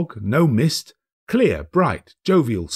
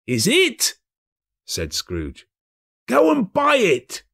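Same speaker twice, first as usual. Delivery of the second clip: put-on asking the boy urgently; cheerful old man; eager Scrooge; 12 semitones higher, slower than usual. Scrooge voiced.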